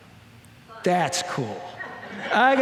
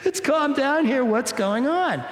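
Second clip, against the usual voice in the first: startled peacemaker; 8 semitones higher, slower than usual. Retold or imitated. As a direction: break up the cursing match, half laughing. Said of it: "And I'm like".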